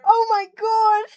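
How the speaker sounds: high, loud shocked scream; shocked shriek. Real, not acted. Streamer reacting to a viewer's filthy keyboard, shocked shriek. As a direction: shriek it out in disgust.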